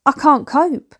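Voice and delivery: normal talking voice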